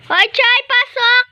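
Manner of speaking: high, loud call. Real, not acted. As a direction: call the cat to come in. Calling the cat inside.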